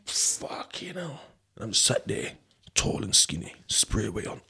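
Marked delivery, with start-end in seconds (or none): 0.1-1.2 breathy whispered aside; whispered thought. Retold or imitated. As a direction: whisper the worry to yourself. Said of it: "And I'm thinking".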